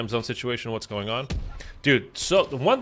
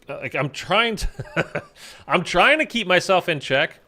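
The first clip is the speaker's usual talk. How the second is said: laughing; 6 semitones higher than usual. Real, not acted. Hyped about the release, laughing.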